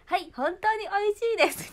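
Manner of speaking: very high, loud polite recital; mother's model answer. Retold or imitated. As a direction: drill the proper wording, falling at the end. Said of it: "ママがずっと言ってる". (丁寧な手本を大声で言い切る口調)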